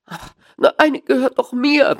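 high, whining protest; whimpering. A narrator's reading. Small man pleading with the dealer for his goose, whimpering. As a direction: whimper it, pitched well up. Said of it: "wimmerte der kleine Mann".

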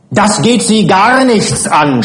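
high, drawn-out angry cry; wütendes Schreien. A narrator's reading. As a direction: shout it indignantly, stretching the words. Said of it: "schrie Frau Adriani". (empört geschrien, hoch und gedehnt)

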